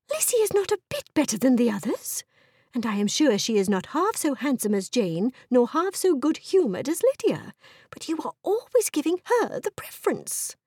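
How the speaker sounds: high-pitched, vexed protest; indignant wife. Acted. Wife objecting to husband favouring Lizzie, indignant. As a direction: object crossly, pitch leaping up.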